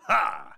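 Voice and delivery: high sharp "Ha!"; a single mocking laugh; short villain laugh. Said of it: "punch in for a laugh at the end".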